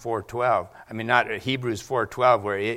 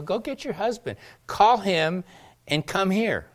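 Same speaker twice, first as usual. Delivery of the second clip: Jesus's command, said lightly higher; an order said a little higher, lightly; 7 semitones higher than usual. Retold or imitated. Jesus voiced, quoted command.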